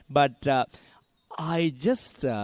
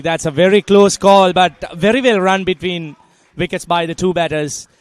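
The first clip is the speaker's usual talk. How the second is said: raised, louder cricket commentary; live play-by-play; 4 semitones higher, 10 dB louder than usual. Real, not acted. Commentator calling a close run to listeners, lively play-by-play. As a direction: lift voice and volume for the close call.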